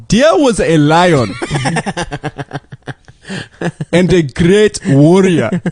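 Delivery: put-on slow, storytelling Scottish accent; a dad's solemn tale in Scottish. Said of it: "That's pretty good Scottish accent".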